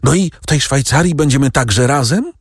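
put-on high boyish question, lightly breathless; mildly breathless, high-pitched child. A narrator's reading. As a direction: call out eagerly as if winded. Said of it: "wołał zdyszany".

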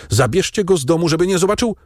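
high, hurried anxious command; urgent order. A narrator's reading. Father orders servants to shield his son, urgently. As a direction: rush it out, pitched high with alarm.

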